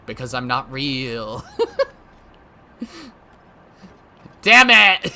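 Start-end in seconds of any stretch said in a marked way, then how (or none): 4.4-5.1 loud, higher yell with a laugh around it; frustrated shout. Pretend. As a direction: curse the dream for not being real. Mock frustration about dream.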